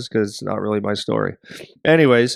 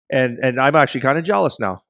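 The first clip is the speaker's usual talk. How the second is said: normal talking voice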